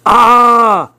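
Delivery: high, loud acted "ah!"; anguished cry. A narrator's reading. Boy stung at being called immature, crying out.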